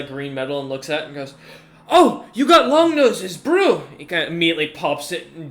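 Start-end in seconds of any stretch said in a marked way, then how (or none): 1.9-3.8 high, loud, drawn-out exclamation; delighted commander. Acted. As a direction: crow over a prized drink.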